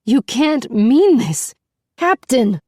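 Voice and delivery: distraught protest. A narrator's reading. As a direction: beg him not to mean it, rising high. Beauty protests her release.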